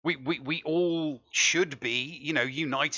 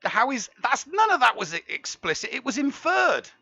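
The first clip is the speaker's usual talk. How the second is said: mock indignation; 9 semitones higher, 3 dB louder than usual. Real, not acted. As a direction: play the innocent, offended. Defending himself, mock indignant.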